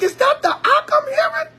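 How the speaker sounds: high, stunned question; can't believe your ears; shocked disbelief. Retold or imitated. Elder overhearing harsh words, high and stunned. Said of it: "I'm like".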